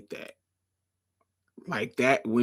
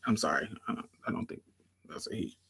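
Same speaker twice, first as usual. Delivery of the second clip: ordinary talk, nothing marked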